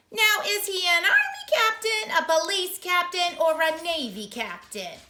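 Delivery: high, loud singsong clerk; clerk on the phone. Acted. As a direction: lilt it like a bored official. City Hall voice on phone.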